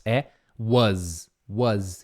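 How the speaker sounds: "was" said "waz" with a z ending; "was" with an open a and a buzzing z; right pronunciation. Shown as a demonstration. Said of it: "Essa palavrinha não é was".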